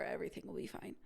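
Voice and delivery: breathy self-soothing, no voice; barely voiced promise it will be fine; whispered reassurance. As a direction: whisper it to yourself.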